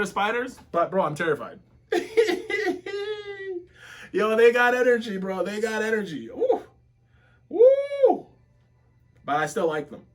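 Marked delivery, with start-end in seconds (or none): 1.9-3.6 squeaky, half-crying giggle, much higher; high nervous laugh. Real, not acted. Spooked viewer breaking into a high nervous laugh. 6.3-8.3 high, rising ooh then a louder woo; excited whoop. Real, not acted. Hyped viewer whooping at the anime fight.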